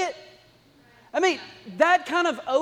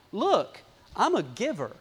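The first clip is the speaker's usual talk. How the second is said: mock liar's voice; 3 semitones lower than usual. Liar voiced in the story.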